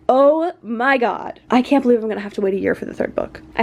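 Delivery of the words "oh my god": slow, stretched-out gasp of disbelief; drawn-out reaction. Retold or imitated. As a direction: imitate a stunned reader, dragging every word. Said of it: "and you're like".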